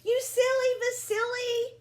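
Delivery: a hammed-up scolding father; teasing father. A narrator's reading. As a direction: chide him lightly as papa. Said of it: "said Papa".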